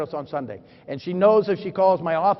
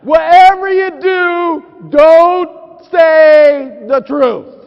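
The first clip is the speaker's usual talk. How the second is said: slow, loud, mock-panicked warning; loud dramatic build; 8 semitones higher, 11 dB louder, much slower than usual. Real, not acted. Husband warns the crowd loudly and slowly: lie or die. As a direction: drag it out like a life-or-death alarm. Wife beside him, tricky question.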